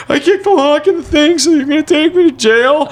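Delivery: put-on high, wailing kid's voice; mock crying. Crying kid confessing to parents, mock wailing. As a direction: blubber loudly in imitation of a panicked child. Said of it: "my parents just found me crying".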